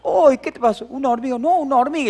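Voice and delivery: high, loud mock yelp and answer; hammed-up childish back-and-forth; retold children's squabble. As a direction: replay a pinched kid's protest. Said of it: "lo pellizcaba y decía".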